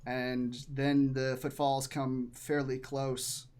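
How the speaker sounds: ordinary delivery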